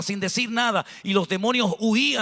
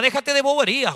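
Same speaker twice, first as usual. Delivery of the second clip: scolding outburst; 6 semitones higher than usual. Acted. Scolding a lazy believer.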